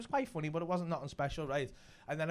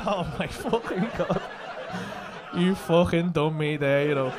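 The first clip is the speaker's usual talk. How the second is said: slow, drawn-out disbelief; retold astonishment; 3 semitones lower, 3 dB louder, slower than usual. Comic fooled by the Scouser, slow astonished disbelief. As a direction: drag out the words as if totally had.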